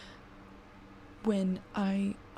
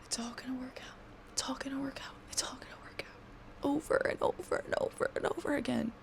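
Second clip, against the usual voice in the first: whispered, hurried self-talk, repeated under the breath; very soft, repetitive self-pep-talk; self-reassuring mantra; 3 semitones higher, 8 dB quieter, faster than usual. Acted. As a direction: murmur it to yourself like a worn-out mantra. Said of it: "I'm supposed to tell myself".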